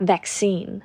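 "vaccine" the American way, with an "ae" vowel; American pronunciation. Shown as a demonstration. Teacher showing learners how Americans say it, American accent. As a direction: say "vaccine" as Americans do, open "ae" first. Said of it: "คนอเมริกันจะออกว่า".

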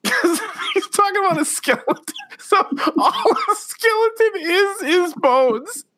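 high, slow, tearful laughing speech; crying with laughter. Real, not acted. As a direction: laugh till the tears come. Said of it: "Rich Keefe is crying on the air right now".

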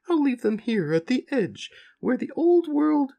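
high, far-off cow creature voice; a big gentle cow soaring up high; talking cow. Acted. Cow reassures the stranded little farmers in a high voice.

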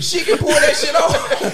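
high, slow laughing words. Real, not acted. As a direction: laugh through the line. Laughing at a joke.

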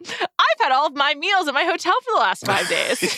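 proud. Real, not acted. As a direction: boast about it happily. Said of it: "But also".

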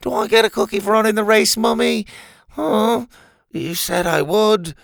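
put-on high, quick little-child plea; dazed childlike voice. Concussed Doctor Rex asks his supposed mother, childlike. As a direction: beg for a treat like a small child.

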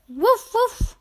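high playful puppy woofs; puppy barking. Pretend. Narrator voicing Biscuit the puppy for kids, high woofs. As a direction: bark like a happy little puppy.

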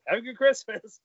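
"Have a good Christmas" high and loud, punched on "Have"; emphatic farewell. Retold or imitated. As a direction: hit "Have" hard in a loud, high send-off. Repeats the sign-off louder.

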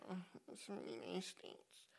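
very soft embarrassed answer; sheepish mumble. Retold or imitated. Scene: admitting nationality abroad, sheepish and very soft. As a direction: mumble it, ashamed.

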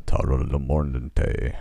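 slow, low rumbling Ent impression. Podcaster showing how Ents talk, slow rumbling voice. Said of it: "talk in that slow, rumbling voice".

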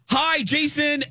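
very high, mock-gushing hello; excited fan greeting. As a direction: imitate two thrilled women calling out. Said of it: "they're very excited".

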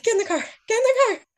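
urgent high-pitched cry, rising on the repeat; retold panic. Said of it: "I was, like, getting a little panicked".

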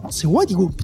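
ordinary talk

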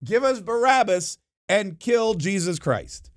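high, slow imitated mob cry; mock crowd yell. Crowd picks Barabbas over Jesus, high mock yell. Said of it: "they all yell".

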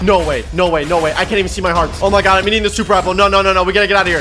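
usual speaking voice

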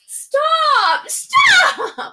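high, loud playful yell; shouting stop. Acted. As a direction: shout it in mock outrage. Mock outrage at a co-host.

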